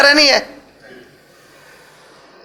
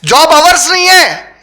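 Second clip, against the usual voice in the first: slow, loud, higher emphatic preaching; preaching climax; 4 semitones higher, 3 dB louder, slower than usual. Acted. Preacher driving home to the congregation that the slave has no hours: slow, loud. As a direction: hammer each phrase slowly and loudly.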